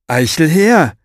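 loud, high call through the woods. A narrator's reading. Strong man calling Mo through the forest, loud and high. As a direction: bellow the name across the trees.

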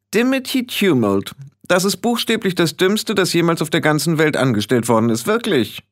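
put-on high-pitched young witch, exasperated; young witch's put-down. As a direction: raise the voice high and sneer at a blunder.

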